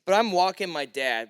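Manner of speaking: usual speaking voice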